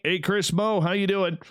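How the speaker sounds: ordinary talk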